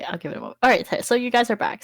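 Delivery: ordinary delivery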